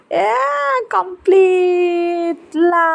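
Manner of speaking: long high wailing cry of defeat; drawn-out cry. Real, not acted. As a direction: stretch it into a high wail. Husband finishes first.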